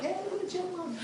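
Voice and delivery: high, bright mock glee at a chipmunk; chipmunk delight. Spotting a chipmunk, he squeals in childlike glee. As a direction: squeal it like a kid spotting a critter. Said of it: "I'm like".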